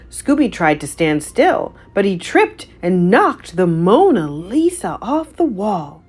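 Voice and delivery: plain reading voice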